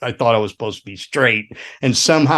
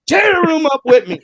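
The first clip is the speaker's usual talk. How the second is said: high-pitched rowdy yell; imitated wild urging; Gary; 14 semitones higher, 4 dB louder than usual. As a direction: holler it like a wrecker recruiting help. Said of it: "He's going".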